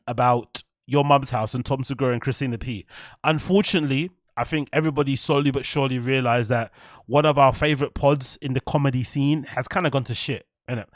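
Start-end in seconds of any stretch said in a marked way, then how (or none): none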